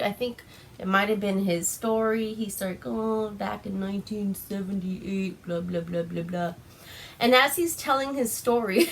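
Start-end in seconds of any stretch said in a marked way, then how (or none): 2.9-6.5 quiet, droning storyteller imitation; mock storyteller. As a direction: drone through it like a tedious tale. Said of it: "he starts telling, like, a story".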